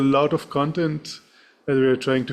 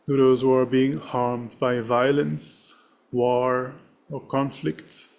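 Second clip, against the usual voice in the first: serene. Acted. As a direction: read the dedication gently with long pauses. Leads a dedication prayer.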